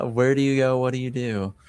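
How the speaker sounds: ordinary talk, nothing marked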